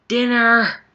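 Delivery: loud high call of Dinner!; mom calling out. Retold or imitated. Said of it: "It comes from my mother".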